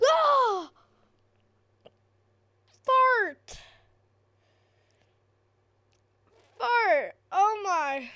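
high, loud frightened outburst; panicked yelp. Real, not acted. Gamer flees the monster, shrieking. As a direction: shriek and bolt.

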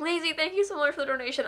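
normal talking voice

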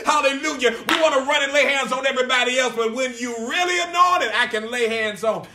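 loud, driving preacher cadence; full-force preaching. Real, not acted. Preacher urging believers to take authority, loud full-force preaching. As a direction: drive each point home like a revival preacher.

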